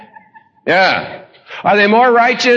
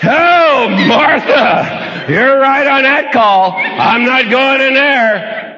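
Jesus at the cave; 6 semitones higher, much slower than usual. Retold or imitated. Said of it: "walks into the cave and goes".

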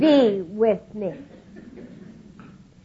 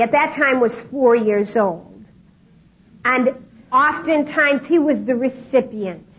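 ordinary talk

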